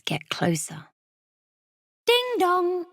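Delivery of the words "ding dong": high, chiming doorbell imitation; sing-song doorbell. A narrator's reading. Doorbell rings as children hide, high sing-song chime. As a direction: ring it out like a bell.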